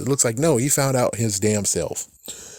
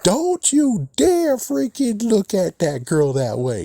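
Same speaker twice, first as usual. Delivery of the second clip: protective warning; 11 semitones higher, slower than usual. Retold or imitated. Protective warning quote, other channel.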